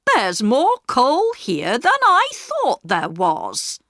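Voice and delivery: a servant surprised by the heap; puzzled housekeeper. A narrator's reading. Mrs Viney at the back door, puzzled by the coal. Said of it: "and said".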